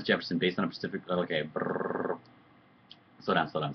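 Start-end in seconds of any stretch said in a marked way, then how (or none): 1.5-2.2 voiceless motorboat brrr; flustered raspberry-like brrr; lip-trill noise. Real, not acted. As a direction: blow a long lip trill. Spelt noise while losing his thread.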